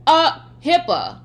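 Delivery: mocking clueless customer. Mock customer answer.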